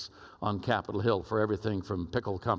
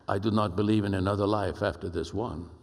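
imitated deep Spanish-accented voice; Pablo's deep Spanish accent; 3 semitones lower than usual. Ambassador confessing disbelief to twenty men, imitated deep Spanish accent. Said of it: "deep Spanish accented voice like this".

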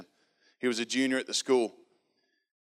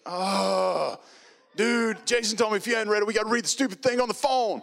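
put-on high, stammering awkward kid voice; nervous freshman; 11 semitones higher, 6 dB louder than usual. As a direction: stumble through it like a shy kid forced to call. Rex explaining Jason's orders.

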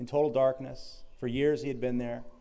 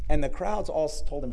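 ordinary talk

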